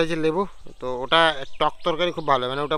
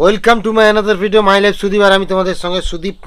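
sing-song vlog intro; 7 semitones higher, 8 dB louder than usual. Acted. Vlogger's opening catchphrase.

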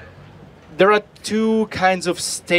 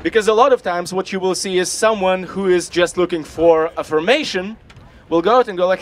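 ordinary talk

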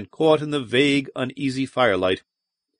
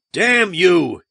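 loud, high roar of strain; 6 semitones higher, 5 dB louder than usual. A narrator's reading. Wocher roars at the monster while forcing its jaws, loud. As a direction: roar it out with all his might. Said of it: "he roared".

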